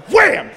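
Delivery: high, loud "wham" like a blow; sudden wham. Retold or imitated. Storyteller mimics grandpa's knife hitting the bluegill, explosive wham. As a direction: punch out the sound of a chop.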